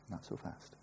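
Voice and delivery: a hushed whispered warning. Acted. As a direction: repeat it as an unvoiced whisper. Madhyamaka quoted, second take.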